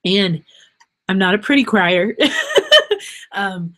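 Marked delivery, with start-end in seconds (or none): 2.2-3.1 a quick, high laugh through the emotion; embarrassed laugh. Real, not acted. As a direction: laugh it off, a little teary. Laughs after saying she cries.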